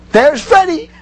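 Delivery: high, loud group cry; exclaiming in unison. Retold or imitated. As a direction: call out his name like a chorus. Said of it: "the entire faculty, in unison, said".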